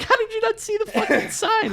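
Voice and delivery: high laughing squeal; hysterical disbelief. Retold or imitated. Wife scolding her husband, high and laughing. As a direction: squeak it out, barely holding the laugh. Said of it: "His wife is crying, like, hysterical".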